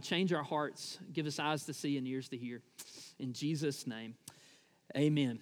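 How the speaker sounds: low, quiet pastor's prayer. Real, not acted. Pastor praying humbly for God to meet the church, low earnest prayer. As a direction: pray humbly, voice dropped low and softened.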